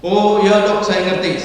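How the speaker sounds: acted daughter catching on at once; daughter on the phone. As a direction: burst in with instant understanding. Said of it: "kata si anak".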